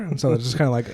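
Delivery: usual speaking voice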